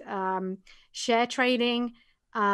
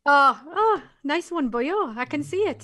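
Welsh accent; 4 semitones higher, 4 dB louder than usual. Shown as a demonstration. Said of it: "That's my Welsh accent".

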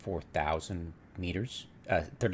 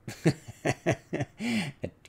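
laugh; 11 semitones higher than usual. Real, not acted. Laughing after the cheer.

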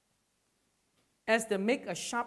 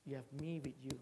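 softer, voiced and gentle; 3 semitones lower, 8 dB quieter than usual. Preacher repeats God's promise to the congregation, soft and voiced. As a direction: say it softly but aloud.

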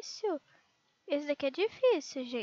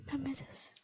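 a scared breathless whisper; 2 semitones lower, 10 dB quieter than usual. Real, not acted. Gamer girl afraid of falling, whispering. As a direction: hush it out in fear.

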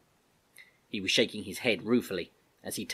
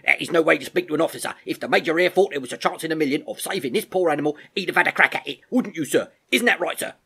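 fast, loud, higher-pitched reprimand; sharp sergeant; 7 semitones higher, 8 dB louder, faster than usual. Acted. Sergeant scolding a soldier before the Major, sharp and loud. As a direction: scold briskly, then appeal to the officer. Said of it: "said Sergeant Thunder sharply".

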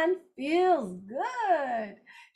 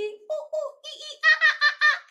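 high hooting monkey calls with a laugh; monkey sounds; 13 semitones higher, faster than usual. Pretend. Teacher acting out the book for kids, high monkey hoots. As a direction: hoot and chatter like a playful monkey. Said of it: "Making sounds like a monkey".

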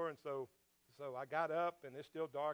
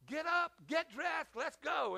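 higher, slow, urgent imitated command; urgent order; 9 semitones higher, slower than usual. Husband telling his wife to dress, urgent. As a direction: hurry her along, mock urgent. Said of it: "I said".

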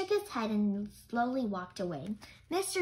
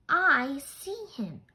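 louder, slow and emphatic; eager discovery; 5 semitones higher, 6 dB louder, much slower than usual. Acted. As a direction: announce the find with excitement. Spotting the man in crowd.

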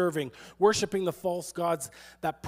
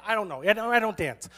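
ordinary talk

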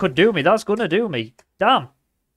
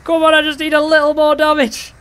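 high, strained-up holler; urgent high plea; 10 semitones higher, 4 dB louder than usual. Real, not acted. Gamer needing a little more damage, high urgent call. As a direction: push it up high and hold it there.

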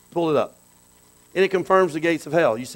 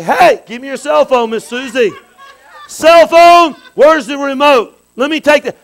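loud, high commanding shout; bossy parent confiscating; 7 semitones higher, 10 dB louder than usual. Acted. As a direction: order the child to hand it over. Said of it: "You go in there and say".

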